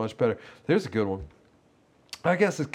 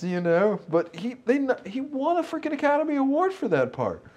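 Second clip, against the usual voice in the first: ordinary delivery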